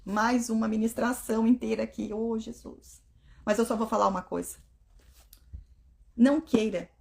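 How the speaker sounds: ordinary talk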